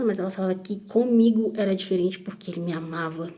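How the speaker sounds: ordinary talk, nothing marked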